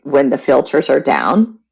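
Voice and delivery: ordinary talk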